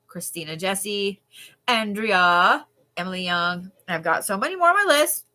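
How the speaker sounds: ordinary talk, nothing marked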